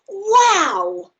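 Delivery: high and loud amazed wow; excited wow. Acted. Boy floats up into the sky, amazed and loud. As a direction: gasp it out in wonder.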